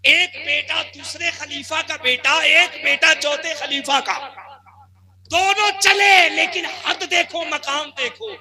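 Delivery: loud, high, ringing sermon delivery; preaching at full force. Acted. Preacher rousing the congregation, loud full-force preaching. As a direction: preach it out over the gathering.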